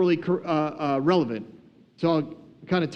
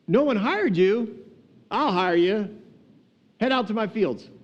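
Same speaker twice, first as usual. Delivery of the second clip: a touch of showy decisiveness, pitched up; lightly acted landowner; 4 semitones higher than usual. Landowner taking on the idle workers, lightly acted decisiveness.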